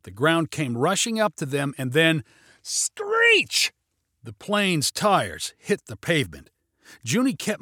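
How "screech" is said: high, long-drawn screech word; imitated tyre screech. A narrator's reading. Narrator voices tyres hitting pavement, drawn-out screech.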